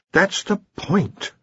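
slow, high, weary insistence; high-voiced and worn down. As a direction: drag it out with tired patience. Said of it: "said Ponder wearily".

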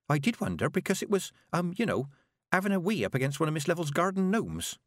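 put-on high, hesitant young girl; girl reporting to the witches; nervous girl. A narrator's reading. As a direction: stammer out the news shyly. Petulia recalling.

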